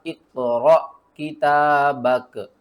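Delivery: इक़रा किताबक read with a jolt on the sukoon; loud, careful Quranic recitation; jerk on the alif. Shown as a demonstration. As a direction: stop the sound sharply in इक़रा किताबक. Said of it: "उसको हम झटके के साथ पढ़ते हैं".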